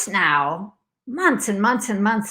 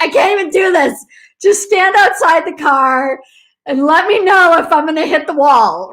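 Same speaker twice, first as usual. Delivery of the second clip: tag withheld; 7 semitones higher, 5 dB louder than usual. Retold or imitated. Retells frantic plea to daughter.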